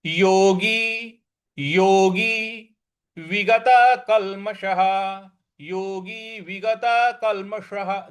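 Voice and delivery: योगी विगत कल्मषः said slowly with stress on कल; slow Sanskrit recitation. Shown as a demonstration. Teacher models the phrase, slow careful recitation. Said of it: "हमको आघात देना है".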